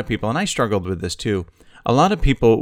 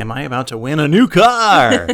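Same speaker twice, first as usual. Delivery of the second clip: excited contestant; 6 semitones higher, 6 dB louder than usual. Pretend. Playing game show contestant.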